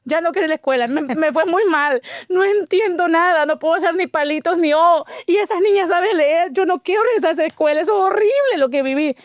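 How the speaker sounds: mock tearful protest, slowing on the worst parts; upset child. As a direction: whine to the señora like the hurt little girl. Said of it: "Regresé enojada".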